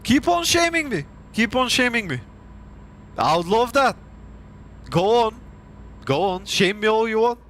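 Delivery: high-pitched taunt daring the haters; sarcastic goading. Real, not acted. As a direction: egg them on in mock delight.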